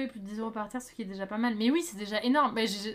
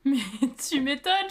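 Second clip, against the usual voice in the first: laughing; 2 semitones higher, 4 dB louder than usual. Real, not acted. Laughing at friend's sweaty story.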